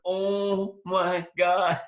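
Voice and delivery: long drawn-out, high and loud mock horror; stunned dismay. Actor finding his scene completely changed, stunned dismay. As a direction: stretch every word in growing dread.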